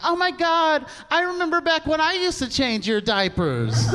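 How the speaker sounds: high, gushing aunt voice; gushing relative. Retold or imitated. Relative at a reunion gushing at him, high voice. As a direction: coo it like a doting relative. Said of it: "the weirdest one is like".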